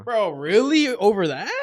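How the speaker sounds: high and loud disbelief; surprised. Real, not acted. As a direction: react in shocked disbelief. Shocked reaction to friend.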